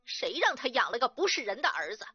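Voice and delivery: sister-in-law defying the mother-in-law; loud defiance. A narrator's reading. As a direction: dare her openly. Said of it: "大声地说".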